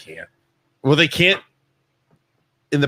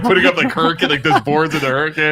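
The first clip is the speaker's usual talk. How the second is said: normal talking voice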